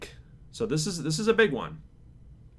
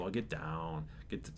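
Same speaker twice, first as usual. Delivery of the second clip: low voice demo; 6 semitones lower, 10 dB quieter than usual. Shown as a demonstration. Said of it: "I'll get low".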